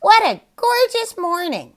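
high squawky parrot voice; squawking yawn. Acted. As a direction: squawk it back sleepily. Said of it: "a squawking voice yawned right back".

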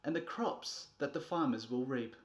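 ordinary delivery

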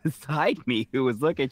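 usual speaking voice

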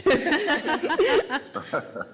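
high shaky laugh after crying; tearful laugh. Real, not acted. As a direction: laugh through the tears. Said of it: "I have been crying so much".

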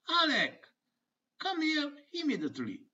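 mother calling out. Acted. Mother calling her son to come at once. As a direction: summon the kid sharply. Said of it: "la mamma risponde e lo chiama strillando".

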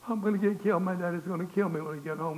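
high, acted boyish dread; panicked wail. Jim dreading his daddy over the cigarettes, panicked. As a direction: wail it as if doomed.

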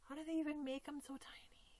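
soft wondering whisper. Real, not acted. Marvelling at the tiny jointed doll, whisper.

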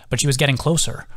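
plain reading voice

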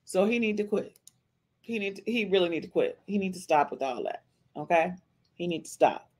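quiet, disgusted aside; muttered scolding. Real, not acted. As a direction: grumble it under your breath. Muttered disapproval.

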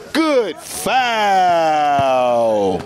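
long, drawn-out announcer call. Acted. Commentator filming pickup ball, drawn-out call. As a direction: stretch "Good foul" out like a broadcaster, low.

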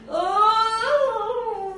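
long, high, loud waking "oh"; waking yawn. Acted. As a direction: stretch out a big morning yawn. Grandmother wakes up in the morning.

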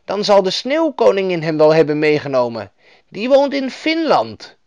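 helpful northern woman, pitched high; Lapland woman. A narrator's reading. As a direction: explain as a knowing storybook woman. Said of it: "vroeg de vrouw".

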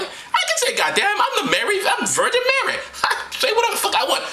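very high falsetto boasting; high-pitched mock diva; defiant Mary. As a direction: insist in a high comic woman's voice. Acting Mary defying Joseph.

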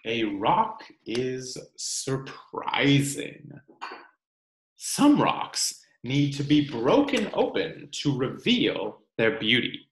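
ordinary delivery

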